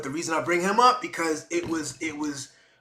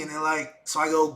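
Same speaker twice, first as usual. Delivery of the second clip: usual speaking voice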